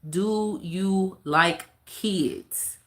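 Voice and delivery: very slow, drawn-out read of a comment; drawn-out mock reading. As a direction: read the viewer question slowly. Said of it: "Ahmed says".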